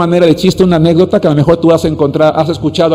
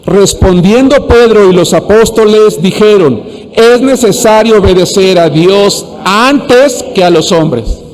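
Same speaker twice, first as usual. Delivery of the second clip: slow, deliberate scripture recitation; congregational reading; 3 semitones higher, slower than usual. Shown as a demonstration. Preacher leads the right-hand section reading Acts, slow and measured. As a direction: lead everyone through the verse, phrase by phrase. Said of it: "todos juntos".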